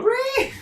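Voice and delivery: high squealing ree shriek; mocking a friend's squealing ree; mock ree scream. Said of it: "did you scream out".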